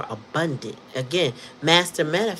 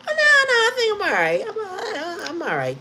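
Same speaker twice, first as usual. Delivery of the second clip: high, giggly brush-off; laughing; 3 dB louder than usual. Real, not acted. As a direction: wave it away while laughing. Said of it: "I'm so tickled right now".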